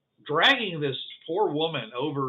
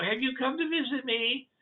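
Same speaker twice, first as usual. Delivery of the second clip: put-on high, eager kid voice; high child's voice asking a visitor; small child voice; 10 semitones higher than usual. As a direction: ask like a curious small child. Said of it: "it's like a very small child".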